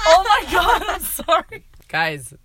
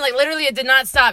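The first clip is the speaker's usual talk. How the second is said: ordinary delivery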